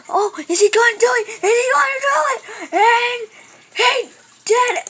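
high, fast, loud squealing; excited screaming. Real, not acted. Player watching whether he makes it, excited high scream. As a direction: scream it in rushed suspense.

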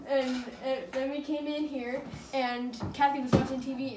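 ordinary talk

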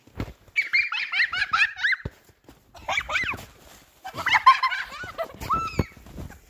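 bursting out laughing, high; helpless giggling fit; loud laughter. Real, not acted. Kids laughing in the snow.

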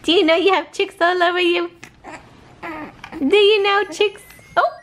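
high sweet singsong. Real, not acted. Baby talk to son.

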